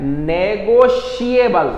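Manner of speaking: "नेगोशिएबल" said very slowly as ne-go-shi-e-bal, each syllable clear; pronunciation demo. Shown as a demonstration. Teacher showing students how to pronounce the word, slow. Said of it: "इसका pronunciation क्या है?".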